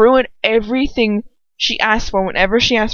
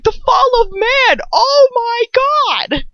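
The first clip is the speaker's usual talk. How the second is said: high, drawn-out exclamation of amazement; exclaimed revelation; 4 dB louder, much slower than usual. Retold or imitated. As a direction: draw it out slowly in a high, hammed-up cry. Acts out own shocked realization.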